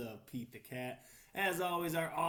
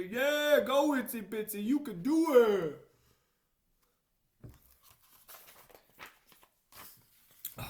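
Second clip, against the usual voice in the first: higher, louder cheer; cheering crowd; 7 semitones higher, 12 dB louder than usual. Acted. Friends below cheer the spider on, excited cheer.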